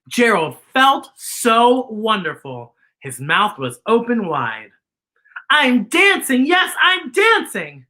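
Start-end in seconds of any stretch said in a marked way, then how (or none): 5.5-7.8 higher, louder, overjoyed; a giraffe thrilled he can finally dance; excited cry. A narrator's reading. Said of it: "Gerald cried".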